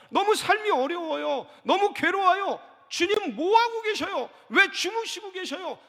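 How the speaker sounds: high, loud anguished complaint; frightened reproachful pleading; panicked disciples. Acted. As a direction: plead with a sleeping master, desperate. Disciples' complaint acted at length.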